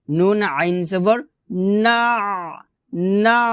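না closed by a squeezed mid-throat ayn; না cut into a pharyngeal ayn; throaty ayn. Shown as a demonstration. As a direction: press the end of না from mid-throat. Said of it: "হলকের মধ্যখান থেকে চাপ দিয়ে".

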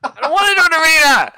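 high, loud, laughing panic; panicked shout. Real, not acted. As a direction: shriek it out in nervous panic. Panicked shout entering arena.

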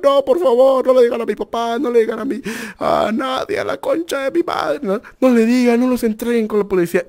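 high-pitched mock crying plea; imitated tearful pleading. Ex-girlfriend begs not to tell her dad, mock crying. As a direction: whimper like a panicked girlfriend, hammed up. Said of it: "estaba llorando, estaba así".

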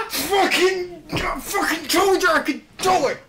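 high, loud victory shout; triumphant yell. Real, not acted. Triumph after success.

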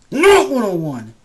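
NOT punched high and loud; high, loud shout starting on "Not"; loud emphatic repeat. Real, not acted. Vlogger corrects viewers on his name, loud emphatic shout. Slogan repeated after insulting assholes.

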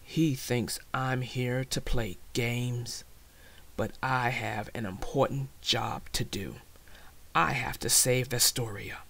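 low, measured creature voice; deep, serious dragon musing; dragon's inner thought. A narrator's reading.

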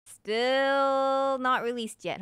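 loud, very slow disbelief; stretched, incredulous complaint; exasperated drawl. Real, not acted. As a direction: drag the words out in mock despair. Exasperated about the game delay.

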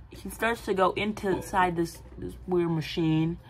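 ordinary delivery

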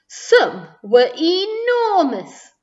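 big, slow, booming rise and fall; hugely emphatic. A narrator's reading. Narrator showing children how huge the sculptures were, grandly. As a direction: stretch ENORMOUS out high and loud.

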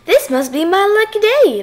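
high, loud crow of delight; jubilant shout. A narrator's reading. Fox rejoices at catching dinner, shouting. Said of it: "the fox shouted".

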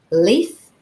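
usual speaking voice

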